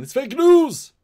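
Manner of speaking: high, loud joking outcry; mock outraged shout. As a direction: yell it in jest. Joking outcry on breaking news.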